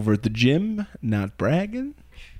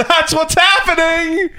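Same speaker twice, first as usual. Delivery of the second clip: high, slow, hammed-up holler; obnoxious shout; 14 semitones higher, 4 dB louder, slower than usual. Client announcing he is leaving the gym, obnoxious shout. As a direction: belt it out in mock defiance. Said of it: "I was so obnoxious about it".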